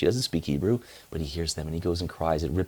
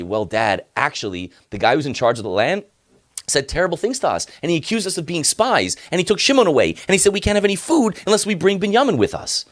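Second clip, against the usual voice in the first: nervous sons explaining; 12 semitones higher, 4 dB louder than usual. Acted. Sons report bad news to father.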